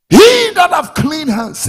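high, drawn-out pulpit shout; preacher's shout. Real, not acted. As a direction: shout the verse slowly to the congregation. Preacher cries out the verse.

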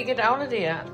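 ordinary talk, nothing marked

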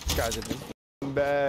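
ordinary delivery

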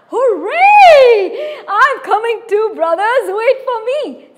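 loud, high hooray; excited shout. Acted. Big goat shouting happily to his brothers. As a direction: call out joyfully across the canyon.

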